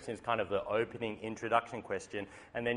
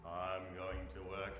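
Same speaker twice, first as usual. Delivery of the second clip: zombie voice; 7 semitones lower, 14 dB quieter than usual. Retold or imitated. Zombie commuters on Monday.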